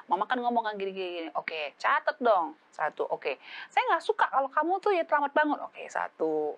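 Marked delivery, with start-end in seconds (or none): 3.7-5.6 pitched-up, louder scolding; hammed-up nagging mother; high scolding mother. Mother listing a complaint.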